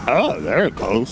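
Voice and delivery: put-on half-hearted old man voice; lazy mock old geezer; whiny nasal old person. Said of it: "that was even worse".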